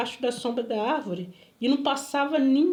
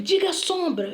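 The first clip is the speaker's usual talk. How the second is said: higher, emphatic imitated order; acted stern command; retold command; 6 semitones higher than usual. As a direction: make them repeat it, as the stranger did. Retold stranger giving orders.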